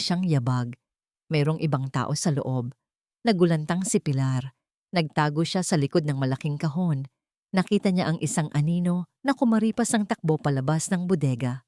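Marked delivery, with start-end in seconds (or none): none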